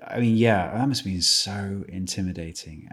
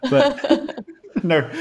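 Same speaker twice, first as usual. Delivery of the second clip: high, laughing denial; 3 dB louder than usual. Real, not acted. Father joking that his kids were unplanned, laughing.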